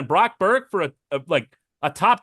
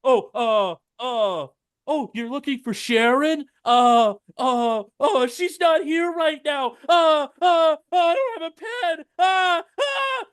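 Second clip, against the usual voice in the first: put-on higher, stammering kid caught answering; nervous teen on the phone; 7 semitones higher than usual. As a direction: play a flustered fifteen-year-old home alone. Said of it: "a fifteen-year-old answering the phone".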